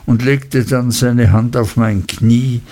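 ordinary talk, nothing marked

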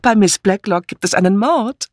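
hoch, mit steigender Aufregung; verkünde die Neuigkeit begeistert; ausrufend. A narrator's reading. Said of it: "rief Misses Harmond". (aufgeregt rufend)

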